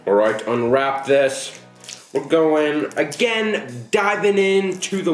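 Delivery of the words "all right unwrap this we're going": ordinary talk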